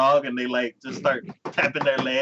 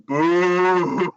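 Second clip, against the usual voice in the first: loud, high, long heckle; high mock booing; drawn-out booing; 6 semitones higher, 6 dB louder than usual. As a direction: boo it like a rowdy crowd. Mock heckling while retelling a show.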